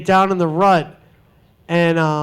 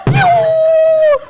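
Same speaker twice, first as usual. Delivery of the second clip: very high sudden yelp; jump-scare squeal; piercing pew sound; shrill scare yelp; 5 dB louder than usual. Retold or imitated.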